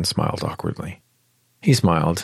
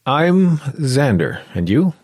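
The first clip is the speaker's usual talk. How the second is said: slow, high and louder, drawn-out; introduction; 8 semitones higher, 5 dB louder, slower than usual. Acted. Xander introducing himself to Clara, slow and drawn-out. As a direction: stretch out the name to impress her.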